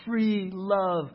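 loud, high, slow swelling prayer about love; preaching at full force. Real, not acted. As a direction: build the prayer into a fervent climax. Impassioned prayer builds.

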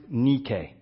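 Nike said NEE-kay, slow and soft; Greek pronunciation. Shown as a demonstration. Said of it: "It's pronounced".

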